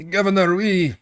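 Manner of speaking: high, strained official's voice; the district leader answers the governor in a high voice; housing district leader voice. Acted. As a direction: pitch it up as a nervous district leader. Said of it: "I'm not gonna roleplay this whole scene".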